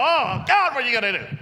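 loud, high cry to God; high opening wail, dropping as it goes; mock panic. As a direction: exclaim like an exaggeratedly panicked pastor. Acts out anxious pastors praying on edge.

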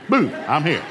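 sudden loud burst on the boom; spring a playful surprise on the kids; jump-out "boom", punched loud; mock pounce. Acts pouncing on his kids.

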